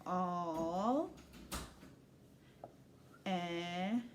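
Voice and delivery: slow separate syllables; slow sounding out. Shown as a demonstration. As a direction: sound it out slowly as you write. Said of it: "Let's sound it out slowly".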